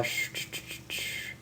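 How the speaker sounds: unvoiced shushing hiss like a faucet; breathy sh-sh of running water; hissing water sound. Shown as a demonstration. Reader mimicking a faucet running water, soft hiss. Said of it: "the sound effect for this".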